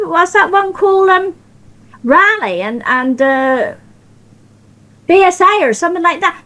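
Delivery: ordinary talk, nothing marked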